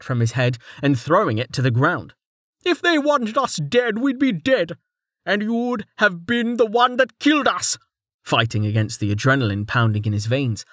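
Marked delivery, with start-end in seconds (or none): 2.7-7.8 put-on much higher, indignant old mayor voice; angry mayor. A narrator's reading. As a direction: lecture the fool furiously. Mayor figure held.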